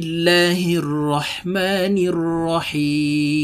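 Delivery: slow melodic tajweed chant; Quran recitation. Acted. Teacher opens the class with slow Quran recitation. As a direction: recite it slowly and reverently, drawing out each vowel.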